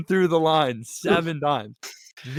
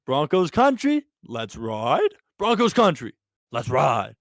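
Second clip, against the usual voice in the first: Russell Wilson. Retold or imitated. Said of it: "exactly what Russ was doing".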